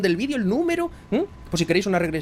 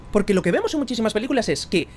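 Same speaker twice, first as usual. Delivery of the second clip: ordinary talk, nothing marked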